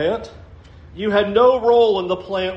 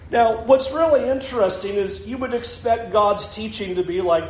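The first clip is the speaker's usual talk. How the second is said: usual speaking voice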